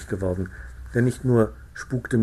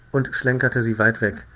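usual speaking voice

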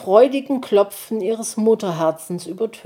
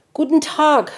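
hoch und laut, voller Freude; begrüß ihn erfreut; freudig; 9 semitones higher, 5 dB louder than usual. A narrator's reading. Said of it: "mit Freude in der Stimme". (freudig begrüßend)

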